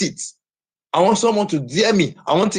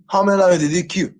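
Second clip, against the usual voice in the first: ordinary delivery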